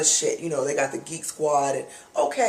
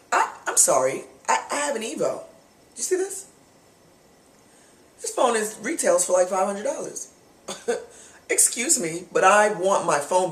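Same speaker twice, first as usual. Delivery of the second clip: high, pitched-up disbelief; indignant; 4 semitones higher than usual. Retold or imitated. Customer protesting to the clerk, pitched high in disbelief. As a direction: rise up in outraged disbelief.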